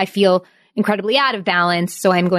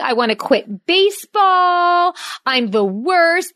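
slow, higher whiny mock sob; mock crying; 7 semitones higher, slower than usual. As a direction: imitate a child crying, slowly. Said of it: "hysterics, crying".

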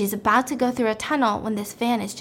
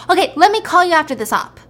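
louder, higher, hurried irritation; annoyed; 6 semitones higher, 7 dB louder, faster than usual. Retold or imitated. Doctor brushing off a phone caller, mock annoyed. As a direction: get the caller off the line, irritably. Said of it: "he gets annoyed".

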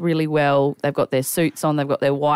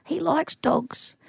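put-on slow, soft, higher old man's voice; elderly man; 10 semitones higher, 5 dB quieter, much slower than usual. Elderly man telling a helper about his grandson, soft and slow. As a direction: quietly voice a sweet old man.

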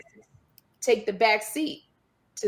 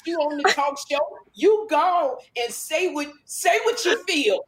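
higher, drawn-out parody voice; mocking co-host imitation; 5 semitones higher than usual. Comedian imitating co-host urging her to speak up, drawn-out mockery. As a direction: stretch the words out as a send-up. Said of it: "I don't talk like that".

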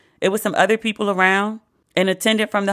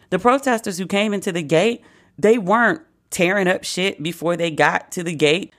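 usual speaking voice

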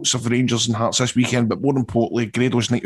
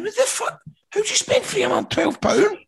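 much higher, squeaky imitation of his own shock; mock disbelief. Retelling to co-hosts his shocked reply to Fletch, squeaky mock disbelief. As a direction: play up the outrage at a mate's huge bill.

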